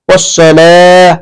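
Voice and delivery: ওয়াসসালাহ slowly, with a heavy স; ওয়াসসালাহ, স read heavy, not as সা; heavy sad. Shown as a demonstration. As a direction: say ওয়াসসালাহ with a thick স. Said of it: "সা উচ্চারণ করা যাবে না".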